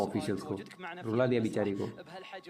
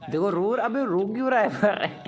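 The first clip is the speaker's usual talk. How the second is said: teasing excitement; 8 semitones higher, 4 dB louder than usual. Real, not acted. Teasing player crying on stream.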